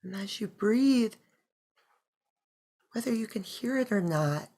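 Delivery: soft, unhurried meditation voice; hushed breath-by-breath guidance; guided meditation. Acted. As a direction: guide them gently into stillness, with long pauses.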